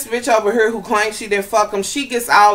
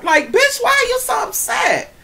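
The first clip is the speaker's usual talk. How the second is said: incredulous shout; 13 semitones higher than usual. Real, not acted. Venting at the crying guest, in a high incredulous shout. Ranting at reality show cast.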